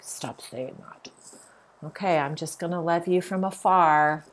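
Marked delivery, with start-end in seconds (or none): 0.0-1.1 mock annoyed whisper. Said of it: "he gets mad".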